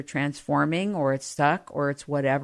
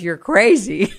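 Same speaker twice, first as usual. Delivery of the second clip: laughing; 3 semitones higher, 5 dB louder than usual. Retold or imitated. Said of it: "And she starts laughing".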